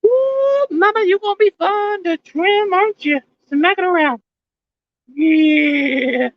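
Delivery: squeaky falsetto, teasing the plant; high falsetto cooing. Pretend. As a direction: coo at her in a tiny high voice.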